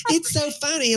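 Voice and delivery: high-pitched mimicking of a giggling friend; mock her amusement in a squeaky high voice; imitating laughing woman. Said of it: "She's like".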